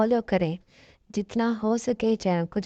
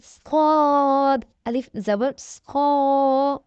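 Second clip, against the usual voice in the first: slow, stretched drill voice, each syllable held long; letter by letter, drawn out and distinct; slow spelling recitation; 4 semitones higher, 3 dB louder, much slower than usual. Shown as a demonstration. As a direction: spell it out slowly for a child learning to read.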